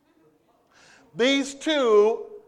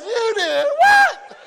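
high, slow, shouted disbelief; husband hearing she told her friends, stunned shout; shocked; 12 semitones higher, 8 dB louder, much slower than usual. Retold or imitated. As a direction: drag it out in shocked outrage, half laughing. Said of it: "he says".

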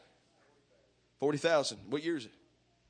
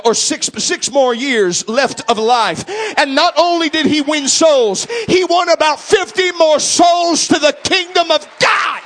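higher, louder, hard-driving preacher; preaching at full force; 10 semitones higher, 12 dB louder than usual. Acted. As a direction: whip the congregation up to a fever. Preaching climax.

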